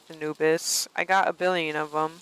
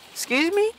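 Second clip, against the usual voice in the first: indignant excuse me; 12 semitones higher, 3 dB louder than usual. Real, not acted. Indignant reaction to game.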